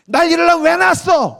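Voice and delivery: high-pitched accusing shout; daughter's angry outburst. Acted. Daughter challenges mother in a fight, furious. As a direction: throw it in mother's face. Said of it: "딸이 이제 비장의 무기를 내세웠어".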